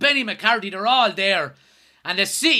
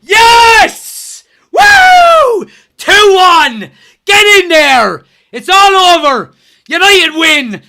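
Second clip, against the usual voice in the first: screaming high and loud with joy; ecstatic celebration; 7 semitones higher, 12 dB louder, much slower than usual. Real, not acted. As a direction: roar the win out. Final whistle, team wins.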